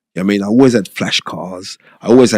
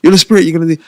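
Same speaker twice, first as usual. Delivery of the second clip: friend's pep talk; 9 semitones higher, 6 dB louder, much faster than usual. Retold or imitated. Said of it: "That's what everyone used to call me".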